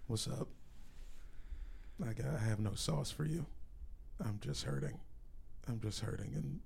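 low, soft, dejected voice; deflated, defeated mumble of a greeting; pained. Real, not acted. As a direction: sound beaten down and hurting, barely any energy. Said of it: "Can hear the pain in his voice".

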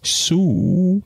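Sue? called up high as a question; calling out a name. Acted. Husband calling his wife from the landing. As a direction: call Sue? into the dark house. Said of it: "and said again".

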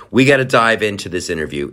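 normal talking voice

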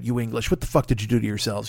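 ordinary delivery

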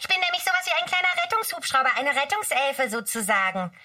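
high, fast elf chatter; Mücke's voice. Acted. Mücke boasts to the seal pup, high and fast. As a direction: brag it up high and quick.

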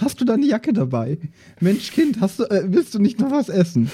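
high fussing governess voice; mother hen clucking over a grown boy; nanny fussing over Justus about his jacket, high and doting. Pretend. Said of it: "die ganze Zeit so".

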